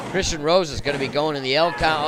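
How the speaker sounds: usual speaking voice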